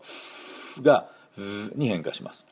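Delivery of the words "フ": "フ" as a long voiceless F; sustained airy hiss of F for "フ"; F sound. Shown as a demonstration. Teacher showing F before it changes to V, "フ" as pure voiceless F. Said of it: "エフの音".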